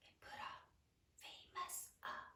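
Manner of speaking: very soft, whispered aside while writing; barely audible self-talk; murmuring to self. Real, not acted. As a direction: whisper it under your breath as you write. Murmuring to self while marking word.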